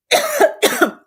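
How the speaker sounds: sharp dry cough; coughing on dust. Real, not acted. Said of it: "I think I got some dust in my throat right there".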